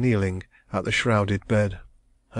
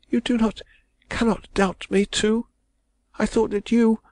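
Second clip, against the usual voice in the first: put-on distressed young woman; 13 semitones higher than usual. A narrator's reading. Young woman protesting her love, high and anguished. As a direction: cry it out as the wronged heroine. Heroine's voice.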